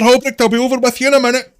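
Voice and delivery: cheerful barkeep. Acted. Barkeep Toblin answering Holbrook across the bar, bright and loud. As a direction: toss it back over the noise, happy to oblige. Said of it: "Toblin's just like".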